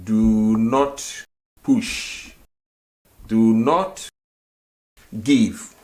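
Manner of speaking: slow teacher gloss; slow, spelled-out meaning. Shown as a demonstration. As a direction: Do not push drawn out very slowly. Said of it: "So here we have mpia".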